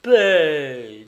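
loud, curt command for silence; stern, loud hush; harsh silencing. A narrator's reading. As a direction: cut the girl off forcefully. Sorceress harshly silences the girl.

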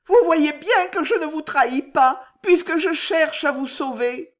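high, loud tearful plea; sobbing. Acted. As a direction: sob out the truth. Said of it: "sanglota Juliette".

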